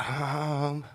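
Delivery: ordinary talk, nothing marked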